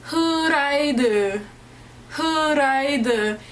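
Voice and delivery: "Huraide" sounded out slowly as hoo-rai-duh; puzzled menu reading of "Huraide", each vowel drawn out; Korean-style pronunciation. Retold or imitated. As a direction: read "Huraide" syllable by syllable, as if unknown. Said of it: "we were like".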